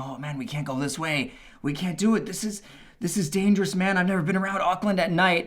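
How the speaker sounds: high, worried mock voice; retold anxious whine of protest; panicky friend.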